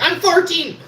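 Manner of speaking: a very high, loud, gleeful cry; triumphant shout. Retold or imitated. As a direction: announce it like a victory. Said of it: "I was finally like".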